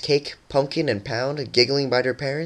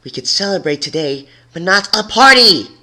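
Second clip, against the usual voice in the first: rising into a loud angry snap, higher at the end; angry outburst; 8 semitones higher, 7 dB louder than usual. A narrator's reading. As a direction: snap it at her, building to a cry on party. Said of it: "snapped the angry Pink Mare".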